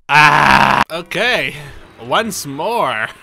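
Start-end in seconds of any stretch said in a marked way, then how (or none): 0.1-0.8 loud startled scream; startled yell. Real, not acted. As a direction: cry out in shock. Sudden game shock.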